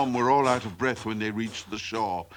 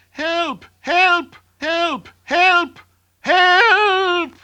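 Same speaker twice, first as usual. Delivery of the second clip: piercingly high chick squeals, building louder; panicked cries for help; 7 dB louder, much slower than usual. A narrator's reading. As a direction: shriek for rescue, last one drawn out.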